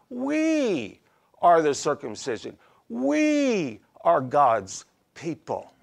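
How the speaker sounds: long, drawn-out "We", then a pause; real attitude. Acted. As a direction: stretch each "We" as a pointed claim. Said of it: "there's some real attitude he's throwing out here".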